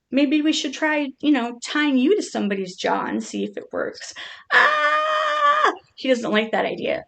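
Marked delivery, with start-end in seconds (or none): 4.5-5.7 a high, loud mock scream for the turtle; high pretend scream. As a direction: scream on the pet's behalf, playfully. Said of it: "I'm screaming for him".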